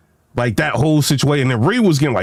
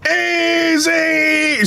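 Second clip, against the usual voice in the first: calling out; 13 semitones higher than usual. Real, not acted. Calling out to Easy laughing.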